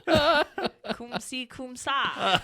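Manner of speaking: high, laughing, mangled fake Italian accent; bad Brad Pitt Italian. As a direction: botch the Italian like a clueless American tourist. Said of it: "sounded like Brad Pitt in Inglourious Basterds".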